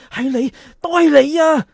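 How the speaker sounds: loud, hurt accusation hurled at a friend; angry accusation. Acted. Boy accuses his friend of exposing his letter, loudly.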